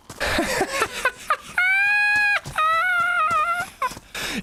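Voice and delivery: long high wheezing maniac laugh; cannibal laugh. Shown as a demonstration. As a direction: cackle long, wheeze out at the end. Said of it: "The, uh, cannibal laugh is more like".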